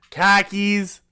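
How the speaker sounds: high, put-on Boston accent; one word in a hammed-up Boston accent. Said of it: "what else is a good Boston one".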